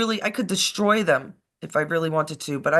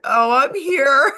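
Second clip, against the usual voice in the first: high, slow, louder, choked with laughter; laughing at her own mistake; laughing reply; 7 semitones higher, 6 dB louder, much slower than usual. Retold or imitated. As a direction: squeal it out through the giggles. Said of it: "And I'm like".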